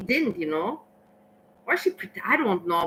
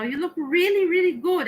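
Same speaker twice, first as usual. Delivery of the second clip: high, bright-eyed praise, pitched well up; overjoyed greeting to a long-lost half sister; delighted compliment; 10 semitones higher than usual. Retold or imitated. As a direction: gush at her with real happiness. Greeting estranged half sister warmly.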